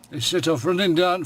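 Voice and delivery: ordinary delivery, nothing marked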